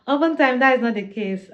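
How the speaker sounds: amused, self-mocking laughter spilling into speech; real laughing. Real, not acted. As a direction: start with a laugh and push the words out loudly through it. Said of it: "I'm laughing because".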